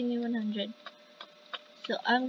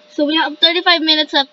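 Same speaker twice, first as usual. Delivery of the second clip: loud, fast, excited blurt; 12 dB louder, much faster than usual. Real, not acted. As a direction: rush it out, thrilled. Excited rush at the deadline.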